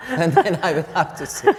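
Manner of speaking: real laughter breaking into the words; laughing. Real, not acted. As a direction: tell it through a laugh. Laughing through her own anecdote.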